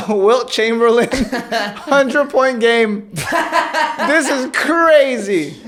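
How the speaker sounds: slow, high words through laughter; laughing. Real, not acted. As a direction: laugh while you say it. Laughing in disbelief.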